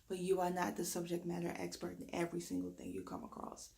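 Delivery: ordinary talk, nothing marked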